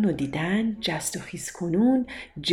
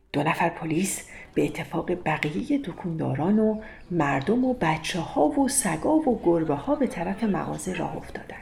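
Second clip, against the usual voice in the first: ordinary delivery, nothing marked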